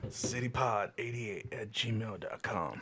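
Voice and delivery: mock announcer. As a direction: read it like a deep radio plug. Repeating the email address.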